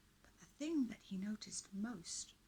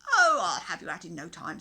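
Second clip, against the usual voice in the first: confident; 18 dB louder than usual. Acted. Knight reassuring the prisoners.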